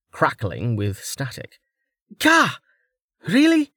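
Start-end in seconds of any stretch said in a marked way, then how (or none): 2.2-3.7 high, annoyed yelp; exasperated outburst. A narrator's reading. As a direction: shriek of disbelief. Hair frizzes with static.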